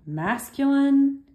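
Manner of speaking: "masculine" drawn out high and loud, syllable by syllable; slow stressed word. Shown as a demonstration. Teacher dictating "masculine" to the class, drawn out slowly. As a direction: stretch out "masculine" so they write it. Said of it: "and write".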